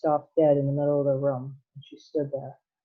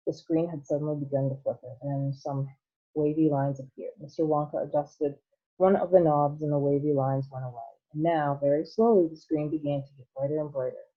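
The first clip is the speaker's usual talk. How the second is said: ordinary delivery, nothing marked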